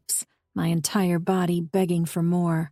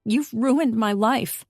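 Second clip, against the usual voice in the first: tearful accusation; 5 semitones higher, 4 dB louder, slower than usual. A narrator's reading. Heroine accuses him, sobbing.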